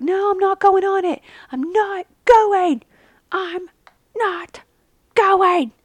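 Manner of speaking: put-on high, loud whiny child refusing; mock tantrum. Daughter refusing to ride, high whiny tantrum. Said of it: "was like".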